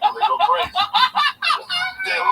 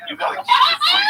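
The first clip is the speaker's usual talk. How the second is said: mock shouted punchline; 5 dB louder, faster than usual.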